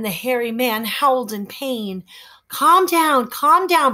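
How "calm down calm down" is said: put-on higher boy voice soothing the howling man; calm him down gently. Boy soothing the howling hairy man. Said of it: "Brogden soothed".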